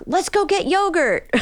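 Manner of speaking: high, chirpy mock parent offering a treat; cheery parent. Therapist mimics parent cheerfully offering a sad kid yogurt.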